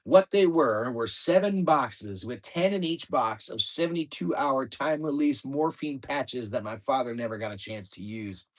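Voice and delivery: ordinary delivery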